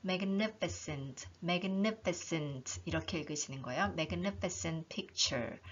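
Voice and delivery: magnificent slow, ni hit hard; stress on ni. Shown as a demonstration. Teacher modelling the stressed syllable in magnificent. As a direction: punch the ni in magnificent. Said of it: "점을 쾅 찍는다고 생각하시고요".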